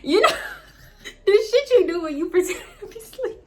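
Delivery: high, laughing through the words. Real, not acted. Telling viewers she faked sleep, laughing hard. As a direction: crack up while explaining.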